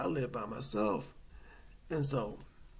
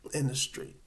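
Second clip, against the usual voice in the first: usual speaking voice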